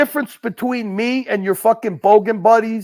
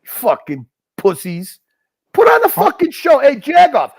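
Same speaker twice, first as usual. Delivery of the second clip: ordinary talk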